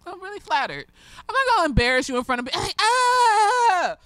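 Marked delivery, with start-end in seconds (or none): 0.1-0.8 polite rejection. Retold or imitated. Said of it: "I'll just be like". 2.6-4.0 high, loud exasperated shriek; frustrated high yell; frustrated cry. Real, not acted. Exasperated outburst about men.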